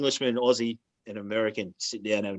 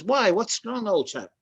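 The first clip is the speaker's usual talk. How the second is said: a plummy chap asking what's wrong; mock Englishman; 9 semitones higher, much slower than usual. Joke teller voicing the Englishman, high mock-posh.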